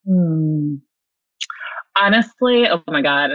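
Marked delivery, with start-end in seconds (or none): none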